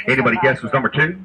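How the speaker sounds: ordinary talk, nothing marked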